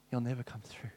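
whispered inner thought; thinking it to himself in a whisper. Retold or imitated. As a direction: breathe it like a private thought.

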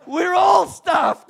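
high, loud panicked cry of being doomed; panicked shout. Retold or imitated. Champion shouting to the American in panic. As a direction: shout it like you just realised you're in trouble.

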